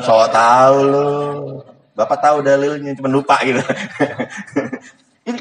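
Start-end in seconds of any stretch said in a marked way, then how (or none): 0.1-1.1 mock scolding. Mock parent scolding child.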